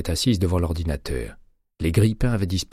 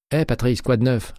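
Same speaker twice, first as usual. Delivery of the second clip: bright, higher and louder hello to a colleague; cheery greeting; 7 semitones higher, 4 dB louder than usual. A narrator's reading. Nurse greeting her colleague, cheerful and upbeat. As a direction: call out cheerfully across the lab. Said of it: "lança-t-elle d'un ton enjoué".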